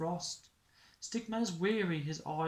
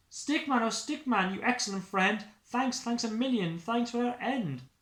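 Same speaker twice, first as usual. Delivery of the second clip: high, louder heartfelt thanks; grateful Santa; 8 semitones higher, 6 dB louder than usual. Acted. Santa thanks Stickman for rescuing him, high and grateful.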